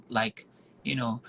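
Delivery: ordinary talk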